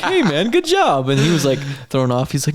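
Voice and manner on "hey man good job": mock friendly. Friendly post-fight hug quote.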